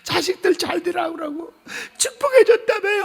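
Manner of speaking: high, sobbing plea for forgiveness; tearful apology. Retold or imitated. Deacon tearfully apologizing to the pastor, crying high. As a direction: weep out the apology, voice breaking high. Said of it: "하고 펑펑 우는 거야".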